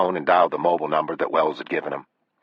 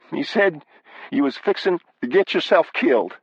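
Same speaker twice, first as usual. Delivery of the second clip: high, mildly tearful voice; understated tearfulness in a high voice; tearful wife; 12 semitones higher than usual. Acted. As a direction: report bad news close to crying. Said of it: "She sounded like she was crying".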